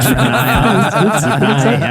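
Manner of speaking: chuckling through the words; laughing. Real, not acted. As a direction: laugh while saying it. Joking about fifteen dollars raised.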